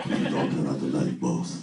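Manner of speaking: low, soft, pleading movie line; a hushed Green Mile impression; Green Mile quote. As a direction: beg softly like the Green Mile man. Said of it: "the dude from the Green Mile".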